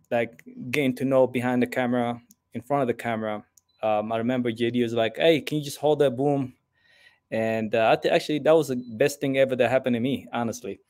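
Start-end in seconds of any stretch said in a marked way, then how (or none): none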